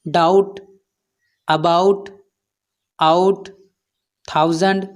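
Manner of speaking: doubt, about, out, thousand with an "ah-oo" glide. Shown as a demonstration. Teacher giving learners examples, "ah-oo" in doubt, about, out, thousand. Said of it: "आउ होता है".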